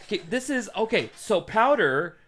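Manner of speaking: high, eager and thrilled; bubbling over with excitement; excited. Real, not acted. Excited about movie.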